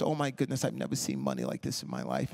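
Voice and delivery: lightly imitated contestant, dropping low; tan lady's voice. Host imitates the tan lady contestant, awed voice. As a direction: do the lady's voice, sinking low and soft. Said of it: "And she had the voice".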